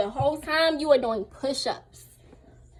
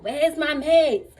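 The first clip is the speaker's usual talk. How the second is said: louder, drawn-out impatient demand; retold angry demand; 3 semitones higher, 6 dB louder, much slower than usual. Patient demanding meds from her carers, angry. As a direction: act out demanding meds, dragging the words. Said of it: "I'm getting angry".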